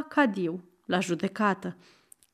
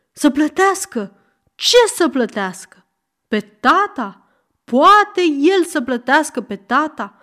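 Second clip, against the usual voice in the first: louder, rising outrage with each question; outraged howl; 5 semitones higher, 6 dB louder than usual. Acted. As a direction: bellow it in disbelief. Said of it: "urlă ovreiul". (urlet revoltat, tot mai tare)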